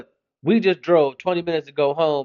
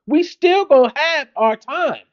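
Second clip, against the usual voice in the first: much higher, slow, stress on still; emphatic; 9 semitones higher than usual. Real, not acted. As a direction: lay down the law with a grin. Parent asserting time with mommy.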